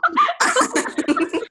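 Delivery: sudden high-pitched laugh bursting out; a shrieking giggle at a friend; high burst of laughter. Real, not acted.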